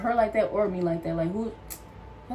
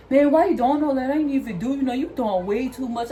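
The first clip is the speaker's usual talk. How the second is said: imitating the supervisor; 4 semitones higher, 4 dB louder than usual. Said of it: "this boy really came back and gonna say".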